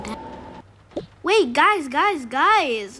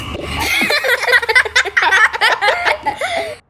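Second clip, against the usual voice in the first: character laugh; 9 semitones higher, 5 dB louder than usual. Acted.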